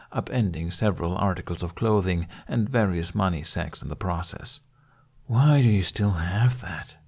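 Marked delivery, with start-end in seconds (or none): 5.3-7.0 slow, weary sighed question; sighing. Acted. Toothless eyeing the hated axe, weary sigh. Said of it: "Toothless sighed".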